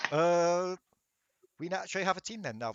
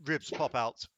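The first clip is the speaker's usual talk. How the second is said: usual speaking voice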